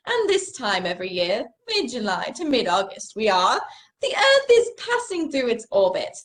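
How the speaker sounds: put-on British accent. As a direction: tell it like a hesitant English lecturer. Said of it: "tell it in a British accent".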